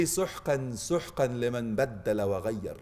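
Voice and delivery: low hushed rebuke, slow at the end; grave low rebuke. Pretend. Preacher voices the rejection on Judgment Day, low and hushed. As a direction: drop low and hush the rejection. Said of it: "will they say".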